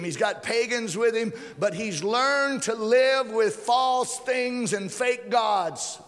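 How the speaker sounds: high, driven sermon delivery. Acted. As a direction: preach it high, stretching the key phrases. Preaches with raised pitch.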